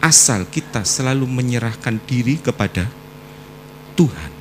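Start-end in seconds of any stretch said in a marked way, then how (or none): none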